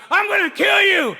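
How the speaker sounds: high, loud blustering yell; drunk jock threat. Acted. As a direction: bellow an empty threat at the top of your lungs. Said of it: "drunk jock".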